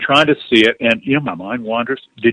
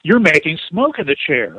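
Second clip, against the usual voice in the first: higher, slow, syrupy praise; gushing flattery; 6 semitones higher, slower than usual. Pretend. Imagined spirit flattering Ken, gushing sweet higher voice. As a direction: lay on the sweet admiration, drawn out. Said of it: "if she had said it like this".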